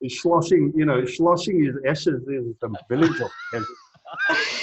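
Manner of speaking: laughing mock Scottish Connery voice; Sean Connery impression. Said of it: "he also said".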